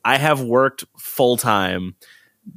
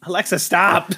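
higher-pitched command to a device; calling to Alexa; 6 semitones higher than usual. Real, not acted. Speaker silencing the smart speaker mid-joke, calling out. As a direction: call out to cut the assistant off.

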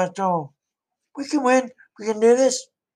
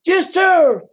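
much higher, loud keen yes sir; eager soldier shout; 11 semitones higher, 7 dB louder than usual. Pretend. Private answers the President, loud eager high shout. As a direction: bark back to your commander with gusto. Pretend phone call with President.

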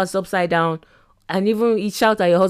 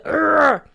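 higher drawn-out "urgh" noise; imitated sending noise; 5 semitones higher than usual. Shown as a demonstration. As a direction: make the noise of it going off. Imitates email leaving on send.

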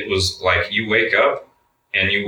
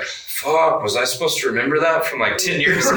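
higher, half-laughing alarm; panicked disbelief; 8 semitones higher than usual. Retold or imitated. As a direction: caught off guard, laughing at his own panic. Panic recalling forgotten code number.

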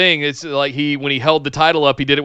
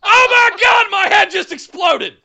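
high, loud mock meltdown; hammed-up fan freak-out; hyped-up shout; 4 dB louder than usual. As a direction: blow your top with over-the-top excitement. Hyped reaction to wrestling fantasy storyline.

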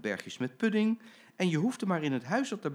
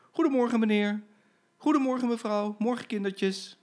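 much higher, sing-song friendly driver; cheerful greetings; 11 semitones higher, 4 dB louder than usual. Acted. Driver Jim greeting boarding passengers, cheerful and innocent. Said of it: "Hij zei gewoon".

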